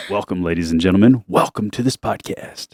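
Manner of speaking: low, hushed show welcome, slowing at the end; ASMR-like host voice. Shown as a demonstration. Dad demonstrating a podcast welcome, low ASMR-like host voice. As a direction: croon the intro low and close to the mic. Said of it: "It's almost like A S M R".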